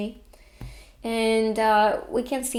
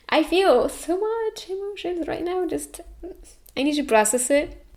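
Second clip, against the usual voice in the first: real high, choked-up voice; tearful, overwhelmed; 7 semitones higher than usual. Real, not acted. Reader overcome with emotions mid-reading, tearful. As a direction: let the tears push the voice up high. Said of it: "and I'm here crying".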